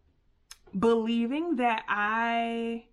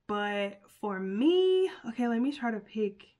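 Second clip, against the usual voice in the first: usual speaking voice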